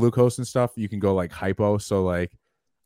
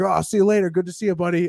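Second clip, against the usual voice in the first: high, breezy friendly goodbye; casual buddy impression; 8 semitones higher, much faster than usual. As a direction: toss it off cheerily like a passing old coworker. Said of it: "He's like".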